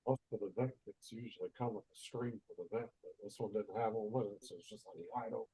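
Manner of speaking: far from the mic, muffled and faint. Real, not acted. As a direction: speak from across the room, muffled. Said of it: "You sound muffled".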